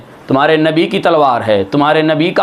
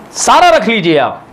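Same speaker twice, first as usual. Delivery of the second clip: raised, insistent repeat; 8 semitones higher than usual. Shown as a demonstration. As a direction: call it out to the crowd, सारा stressed high.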